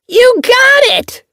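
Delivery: very high, loud, slow cheer; excited kitten voice. A narrator's reading. Fuzzball excited at catch.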